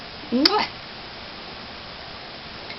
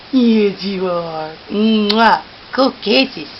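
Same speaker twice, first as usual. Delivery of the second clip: ordinary talk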